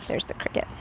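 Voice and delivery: breathy whisper aside. Real, not acted. Aside to audience, unvoiced.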